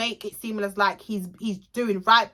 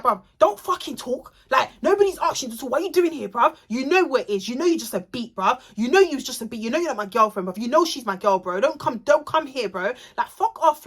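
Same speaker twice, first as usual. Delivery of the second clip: acted-out rant, higher in pitch; angry telling-off; 3 semitones higher than usual. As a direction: tell them off in a heated retelling. Acts out him telling Robin off.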